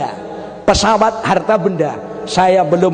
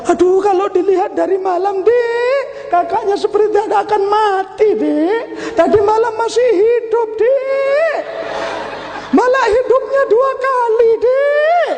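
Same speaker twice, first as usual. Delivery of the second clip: much higher, whining mock-grief voice; mock mourner; 14 semitones higher than usual. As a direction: ham up a confused mourner's wail. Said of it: "Dia jawabnya juga keliru". (pelayat yang meratap dibuat-buat, suara tinggi)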